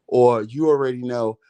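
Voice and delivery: ordinary talk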